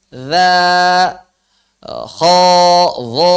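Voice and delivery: kho and dho heavy and rounded; loud drill with kho and dho thick; thin and thick letters. Shown as a demonstration. Teacher drills thin and thick sounds for students. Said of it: "Beda suaranya".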